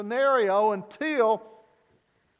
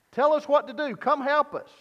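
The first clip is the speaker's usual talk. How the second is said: raised, higher cry for help; desperate plea; 6 semitones higher, 4 dB louder than usual. Acted. Disciples in the sinking boat crying out to Jesus, raised desperate plea. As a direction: call out to Jesus for rescue.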